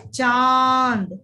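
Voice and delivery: चांद drawn out, nasal vowel held; slow clear model. Shown as a demonstration. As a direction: say चांद slowly for the class. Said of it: "इन शब्दों का उच्चारण करें".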